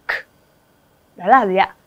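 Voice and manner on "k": bare voiceless click of "k"; isolated K sound. Shown as a demonstration. Teacher isolating the consonant in character, bare K. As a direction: release "k" with no vowel. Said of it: "mà nó là âm".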